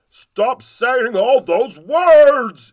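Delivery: high, loud and slow goblin yell. Acted. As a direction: yell it at the children. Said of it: "yelled King Giblets".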